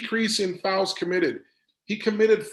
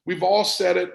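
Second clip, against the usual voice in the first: ordinary talk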